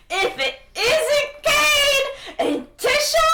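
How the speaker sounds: high, slow, booming welcome; overjoyed greeting. Acted. Merlin to Cain and Tisha on reunion, hammed-up joyful bellow. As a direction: hail old friends at full volume.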